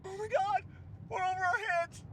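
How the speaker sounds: usual speaking voice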